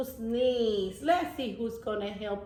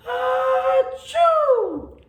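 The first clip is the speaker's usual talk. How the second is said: loud high achoo; a huge theatrical sneeze; big sneeze; 13 semitones higher, 9 dB louder than usual. Acted. Wildcat demonstrates sneezing, loud achoo. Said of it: "then I sneeze".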